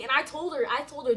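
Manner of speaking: usual speaking voice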